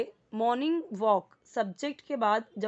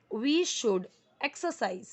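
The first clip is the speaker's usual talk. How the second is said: very slow, each word spaced; slow model sentence; much slower than usual. Shown as a demonstration. Teacher reading example sentence, very slow. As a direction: read it for the class to copy. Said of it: "model sentence read slowly".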